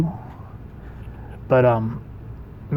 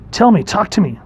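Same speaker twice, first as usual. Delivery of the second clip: higher, urgent demand for an answer; mildly acted desperate plea; 7 semitones higher, 3 dB louder than usual. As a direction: beg for a reply, lightly dramatized. Trickster begs hero to answer.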